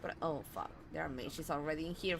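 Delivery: ordinary talk, nothing marked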